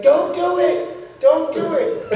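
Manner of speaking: high, loud, drawn-out plea; frantic begging from the ring, hammed up; alarmed warning shout. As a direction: cry out in mock alarm. Opponents begging him not to jump.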